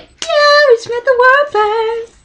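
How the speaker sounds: dazed high squeak. Retold or imitated. Dazed fangirl reaction.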